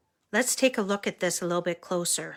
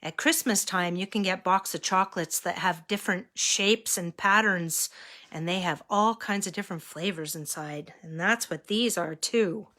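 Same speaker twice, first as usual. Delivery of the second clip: ordinary delivery, nothing marked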